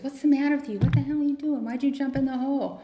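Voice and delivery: a man baffled that someone jumped into his hole; startled alcoholic. Acted. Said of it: "the active alcoholic says".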